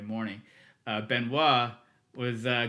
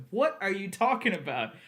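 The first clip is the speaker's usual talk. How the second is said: higher-pitched incredulous questions, breaking into a laugh; baffled disbelief; 7 semitones higher than usual. Retold or imitated. Assistant told a train is audible, baffled disbelief. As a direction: imitate being amazed and lost.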